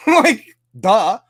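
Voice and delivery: high, loud laughing duh. Real, not acted. Streamer laughs at his own rant, mocking duh.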